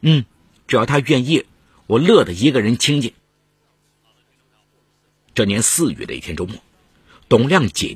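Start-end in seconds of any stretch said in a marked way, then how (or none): 0.0-3.1 readily agreeing, louder and brisk in tone; a touch of glad relief; pleased. A narrator's reading. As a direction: happily welcome the idea, emphatically. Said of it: "杨敏玉一听就高兴".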